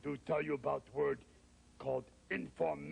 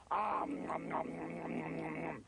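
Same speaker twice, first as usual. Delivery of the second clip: growly rapid nom-nom munching; noisy gobbling; 2 semitones higher than usual. Acted. Monster eats the cookie, greedy noisy munching.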